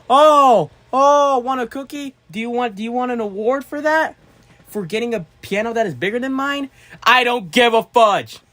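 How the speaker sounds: high, loud, hammed-up outburst; mock rage rant. Snapping at a chatter's bigger piano, high and loud mock rage. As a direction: bite the chat's head off in fake fury. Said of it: "I just had to let off my anger".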